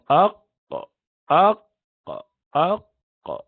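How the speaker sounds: showing the qaf articulation; qaf demo. Shown as a demonstration. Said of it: "you pronounce the letter qaf".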